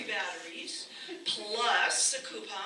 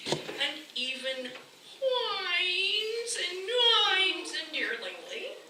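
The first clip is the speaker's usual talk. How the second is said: whining; 9 semitones higher, slower than usual. Acted. Imitates toy dog whining.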